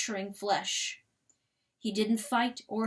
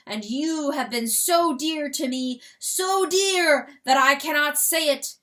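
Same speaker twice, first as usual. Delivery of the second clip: rooster's farewell speech; 6 semitones higher, 10 dB louder than usual. Acted. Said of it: "crowed the rooster to the herd".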